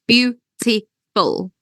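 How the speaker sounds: word split into three slow beats; "beautiful" broken into separate syllables with clear gaps. Shown as a demonstration. Teacher counting syllables for the class, beautiful said syllable by syllable. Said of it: "When we say it, it's".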